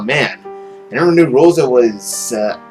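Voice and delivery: ordinary delivery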